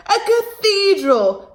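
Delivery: high, slow voice with a laugh; amused butterfly guessing aloud; giggly butterfly. Acted. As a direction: marvel at it like a tittering butterfly.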